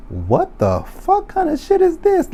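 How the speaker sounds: high, slow squeal of outrage; outraged squeal. Real, not acted. Viewer squealing in outrage at the forced wedding. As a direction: stretch it out high and appalled.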